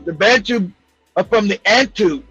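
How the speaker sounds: ordinary delivery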